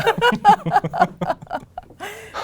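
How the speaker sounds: loud, high burst of laughter; real laughing fit. Real, not acted. Friend reacting to the viral concert story, laughing hard. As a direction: crack up helplessly.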